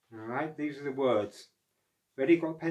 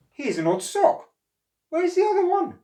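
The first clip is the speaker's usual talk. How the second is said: very high, louder puzzled question; puzzled otter; 11 dB louder than usual. Acted. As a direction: wonder where the other sock went.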